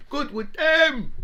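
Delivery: put-on high, slow, drawn-out Irish accent; exaggerated Irish accent, high and loud. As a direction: stretch it out in a mock Irish accent. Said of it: "Obviously in an Irish accent".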